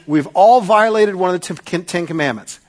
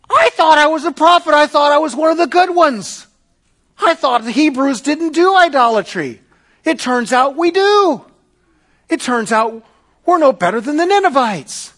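Jonah confessing; 8 semitones higher, 5 dB louder than usual. Acted. Jonah voiced confessing his idolatry.

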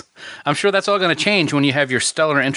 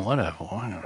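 dismissive mutter; 5 semitones lower, 9 dB quieter, faster than usual. Retold or imitated. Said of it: "in her mind, she's going".

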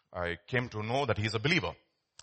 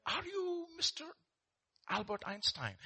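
a much higher, hushed voice of a starstruck conductor; awed ticket collector; 9 dB quieter than usual. Acted. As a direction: ask it softly, not quite believing who's in front of you. Ticket collector recognises Einstein.

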